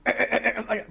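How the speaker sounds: sharp staccato "ack, ack" like an anti-aircraft gun; ack-ack gun noise. Retold or imitated. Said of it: "I go".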